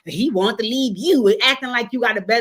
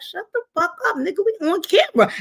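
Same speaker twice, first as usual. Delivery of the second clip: hushed warning; 10 semitones higher than usual. Retold or imitated. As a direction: hiss the warning under breath. Wife hushing him on camera.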